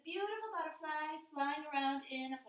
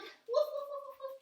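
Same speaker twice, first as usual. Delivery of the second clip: playful dog bark; 10 semitones higher than usual. Pretend. Teacher acting out the dog for kids, high barking. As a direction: yap like an excited little dog.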